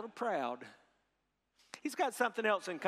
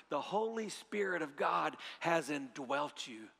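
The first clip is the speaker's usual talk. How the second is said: usual speaking voice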